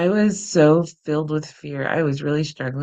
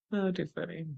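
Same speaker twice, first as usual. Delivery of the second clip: normal talking voice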